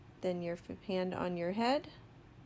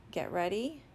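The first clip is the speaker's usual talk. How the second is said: normal talking voice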